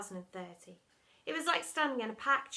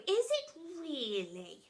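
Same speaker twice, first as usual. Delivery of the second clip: dotty surprise; 7 semitones higher, slower than usual. A narrator's reading.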